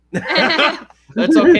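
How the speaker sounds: laughing. Real, not acted. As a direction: laugh the words out. Laughing at reading trouble.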